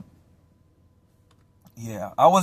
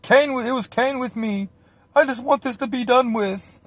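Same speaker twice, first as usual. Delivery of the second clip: high, loud whiny caricature; mock snitch; 12 semitones higher, 5 dB louder than usual. Accomplice giving up his friend to police, high mocking cave-in voice. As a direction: imitate him caving, pitched way up. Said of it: "finally he snitches on me".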